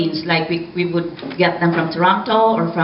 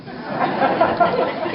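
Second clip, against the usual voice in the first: breathy laugh; 10 semitones higher than usual. Real, not acted. Laughs about dating in English.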